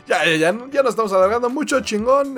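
high, fast and loud; excited. Real, not acted. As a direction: rush the goodbye with energy. (emocionado y rápido)

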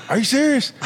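high, disbelieving "Are you serious?"; incredulous coworker. Retold or imitated. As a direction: raise it up in disbelief. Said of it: "he's all".

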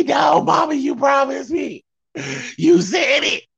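high, hammed-up crying protest; mock tantrum wail. Imitating the girl rejecting her mom's comfort, mock tantrum wail. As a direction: bawl it like an inconsolable kid, exaggerated.

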